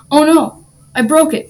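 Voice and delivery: dismayed cry. A narrator's reading. Patrick thinks he broke the jar, crying out. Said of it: "Patrick cried".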